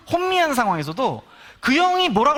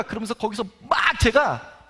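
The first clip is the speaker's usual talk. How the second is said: ordinary talk, nothing marked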